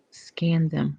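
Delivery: a sudden hushed whisper. Real, not acted. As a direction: drop to a breathy whisper. Reading a chat message aloud.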